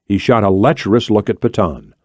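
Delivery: usual speaking voice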